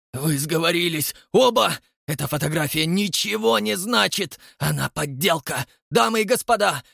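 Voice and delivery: roaring. A narrator's reading. Elder Rastopchin to the audience, furious roar. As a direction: bellow it at the whole hall. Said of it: "взревел он".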